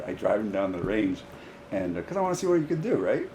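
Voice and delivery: ordinary talk, nothing marked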